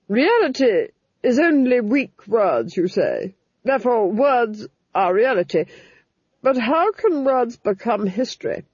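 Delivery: a plotter thinking aloud; scheming duchess. A narrator's reading. Duchess questioning the Fool on history, calculating.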